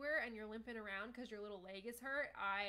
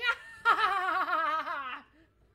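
booming villain laugh, rising; a gleeful cat villain's triumphant laugh; loud evil cackle; 6 semitones higher, 17 dB louder than usual. Acted. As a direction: throw back your head and cackle at the fools. Villain laugh after fooling them.

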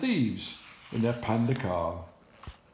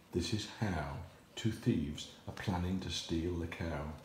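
hushed warning; 7 semitones lower, 9 dB quieter than usual. Acted. Ladybird secretly warning the animals.

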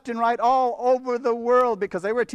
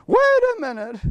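1 mock startled; 9 semitones higher, 3 dB louder than usual. Repeats with high pitch.

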